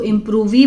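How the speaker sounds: इम्प्रूवी with the final E sounded as "ee"; children's misreading. Retold or imitated. Teacher showing how some children misread it, इम्प्रूवी ending in a sounded "vee". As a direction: add an extra syllable, इम्प्रूवी as "im-proo-vee". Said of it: "कुछ बच्चे इसको इम्प्रूवी बोलेंगे".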